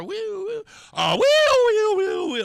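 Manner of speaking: high wailing siren, swelling to loud; very high "woo", building to a blare. Retold or imitated. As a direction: imitate a siren closing in. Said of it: "It's getting louder and louder".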